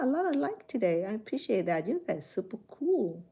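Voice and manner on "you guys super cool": ordinary talk, nothing marked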